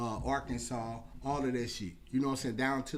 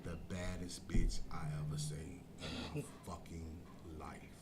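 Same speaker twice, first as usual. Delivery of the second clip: whispered, lower, with a laugh; awed whisper; 10 semitones lower, 10 dB quieter than usual. Retold or imitated. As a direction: breathe it out in disbelief. Awed remark about the woman.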